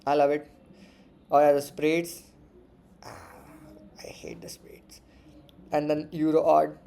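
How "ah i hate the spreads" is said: hushed, unvoiced grumble; breathy whispered complaint; whispered aside. Real, not acted. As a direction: whisper it to yourself in disgust. Quiet grumble about spreads.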